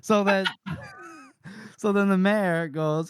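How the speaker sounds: ordinary talk, nothing marked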